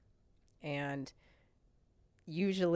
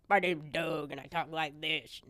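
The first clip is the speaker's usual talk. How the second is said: lightly voiced big Montana husband, ordering the kids; Doug impression; 4 dB louder than usual. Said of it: "I'm gonna imitate him the best I can".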